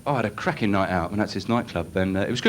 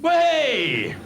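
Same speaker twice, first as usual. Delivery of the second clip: high, drawn-out mock cheer; mock triumphant whoop. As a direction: cheer it like a bored fan.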